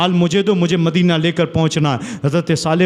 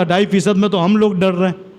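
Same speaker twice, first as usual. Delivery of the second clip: ordinary talk, nothing marked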